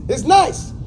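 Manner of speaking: normal talking voice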